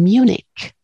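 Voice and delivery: "Munich" said English-style, MYOO-nik; English pronunciation. Shown as a demonstration. Linguist showing how English speakers say "Munich", MYOO-nik. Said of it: "en inglés ya sabes que dicen".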